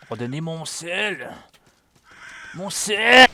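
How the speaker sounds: slow, hammed-up wail for lost loot; a playful cry of loss; mock lament. Player lost his salt to an enemy. (lamentation exagérée)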